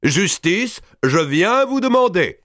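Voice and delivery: high, ringing voice of a proud giant lord; booming Robert. A narrator's reading. Robert addresses the king before his vassals, high and proud.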